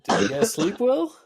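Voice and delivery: high, loud creepy hostess; sweetly sinister innkeeper voice; creepy NPC voice. Acted. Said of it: "she's like".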